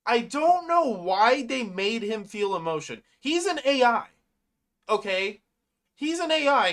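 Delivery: real exasperation, insisting on the obvious; exasperated. Real, not acted. Fan objects to an AI having feelings, exasperated. Complaining about the writing.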